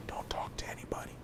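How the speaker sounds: hushed, fully whispered warning; careful whisper. Retold or imitated. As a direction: whisper it so nobody else overhears. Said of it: "I have to be careful and say".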